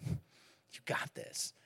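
whispered and drawn out very slowly; dad whispering a last slow reassurance to his son; slow tender whisper. Retold or imitated. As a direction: let it linger, soothing. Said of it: "comfort him".

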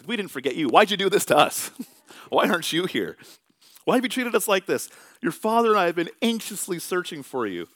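put-on mother half-blaming the boy she lost; scolding mother. Mother scolding twelve-year-old Jesus.

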